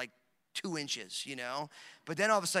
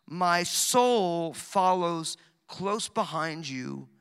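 slow emphatic reading; 5 dB louder, much slower than usual. Real, not acted. Preacher reading verse eight to the congregation, very slow. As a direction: stretch every word, leaning on soul.

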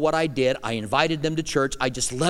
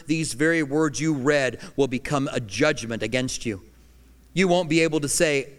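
ordinary talk

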